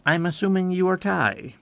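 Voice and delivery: ordinary talk, nothing marked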